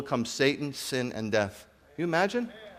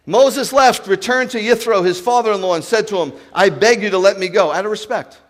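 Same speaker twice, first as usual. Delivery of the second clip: preaching at full force; 6 semitones higher, 9 dB louder than usual. Acted. Loud preaching narration.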